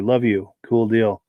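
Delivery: normal talking voice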